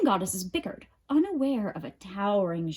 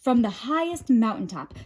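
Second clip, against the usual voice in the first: plain reading voice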